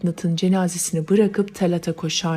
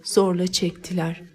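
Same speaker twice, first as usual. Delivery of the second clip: ordinary delivery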